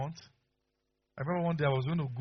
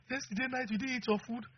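tearful wife; 11 semitones higher, 5 dB quieter than usual. Retold or imitated. Wife's tearful reason.

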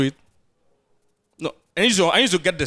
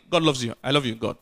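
lower, softer, fatherly reassurance; tender voice of God; 8 semitones lower, 4 dB quieter than usual. Retold or imitated. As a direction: soothe the sinner like a loving father. God voiced answering sinner.

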